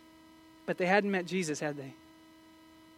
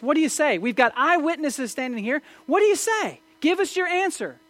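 loud, high, badgering demands; pounding questions; 7 semitones higher, 6 dB louder than usual. Accusers badgering Jesus to answer: loud, mounting demands. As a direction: hammer him with the question, rising each time. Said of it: "They're pounding him".

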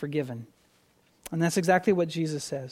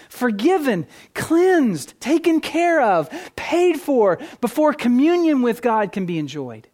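raised pitch, punchy preacher's delivery; emphatic preaching; 6 semitones higher, 6 dB louder than usual. Real, not acted. Preacher telling congregation why Jesus removed sins, emphatic preaching. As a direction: drive each point home with force.

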